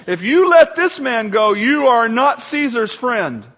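loud, high accusation slowing down at the end; crying out. A narrator's reading. The crowd warns Pilate against releasing him, crying out. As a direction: cry it out as a public warning.

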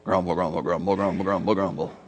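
deep, soft muttered grumble, grumble; a low undertone of grumbling under the breath. Shown as a demonstration. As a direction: growl out grumble low and quiet like a discontented murmur. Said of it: "this word in the Greek language sounds like".

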